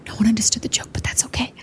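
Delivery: hushed unvoiced whisper; stealth whisper. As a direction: whisper it so the guards miss it. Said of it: "stealth whisper".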